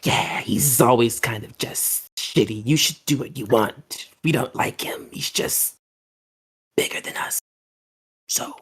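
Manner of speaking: slightly slowed, hushed grumble; lightly voiced dragon, fading toward a whisper; green dragon. Green dragon confiding about the bigger one, a little hushed. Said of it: "the green dragon kind of comes over".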